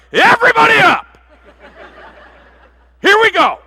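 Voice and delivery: very high, very loud rallying shout; excited shout. Acted. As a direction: yell it like waking a whole house. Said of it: "She's like".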